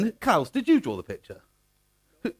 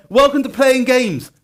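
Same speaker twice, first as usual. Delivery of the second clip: loud, high, drawn-out title announcement; big announcer welcome; 8 semitones higher, 9 dB louder, slower than usual. Real, not acted. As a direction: announce it grandly to the whole room. Announcing the talk's title to audience.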